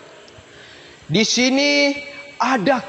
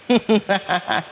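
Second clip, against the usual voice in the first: low, soft theatrical chuckle; stage chuckle; 4 semitones lower, 4 dB quieter than usual. Acted. Rector chuckles after his boast. (tawa kecil bernada rendah)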